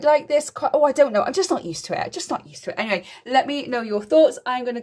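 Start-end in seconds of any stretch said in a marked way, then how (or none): 2.0-2.8 a soft unvoiced echo of the line; whispered repeat. Real, not acted. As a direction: whisper it to yourself. Repeats line, second time whispered.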